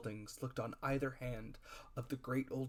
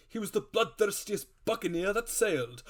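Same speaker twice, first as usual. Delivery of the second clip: high, loud blustering boast; the squire boasts of Flint's fame, high and loud; 8 semitones higher, 6 dB louder than usual. A narrator's reading. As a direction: puff up and bluster.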